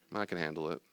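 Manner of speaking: soft, settled reply; calm self-talk. Acted. Calm self answering job worries, soft and settled. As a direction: reassure yourself quietly, unruffled.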